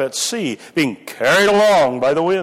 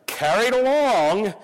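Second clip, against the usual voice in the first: "carried along" said slowly and raised, both words weighted; slow emphasis; 6 semitones higher, slower than usual. Shown as a demonstration. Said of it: "and here's the expression".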